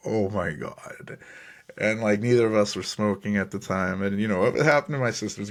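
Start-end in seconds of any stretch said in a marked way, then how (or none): 0.1-1.2 slow, drawn-out oh my God; stunned disbelief. Retold or imitated. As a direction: let it sink in slowly. Shocked at weed found.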